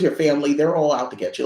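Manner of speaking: ordinary talk, nothing marked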